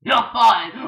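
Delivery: loud shout. Acted. Boss firing the bird, a loud shout. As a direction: yell it out loud.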